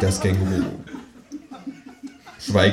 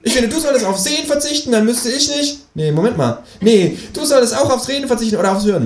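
high, tangled retort; bickering kangaroo. Acted. Kangaroo bickers with the narrator, high. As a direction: argue and lose the thread.

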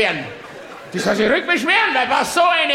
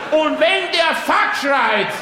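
normal talking voice

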